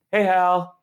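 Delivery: a casual hello with a hint of [name]; [name] greeting. Rock star hailing the speaker in a hallway, lightly imitated. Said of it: "like that from [name]".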